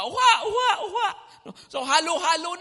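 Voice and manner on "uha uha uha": high imitated sobbing; mock wailing. Preacher imitating old people weeping, high mock wail. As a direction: wail like mourners. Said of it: "Sabi nung iba".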